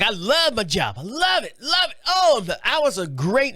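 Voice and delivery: high, swooping gush of delight; singsong, high-pitched raving; mock gushing. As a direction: imitate someone gushing, drawing out the words. Retelling older coworker gushing about job.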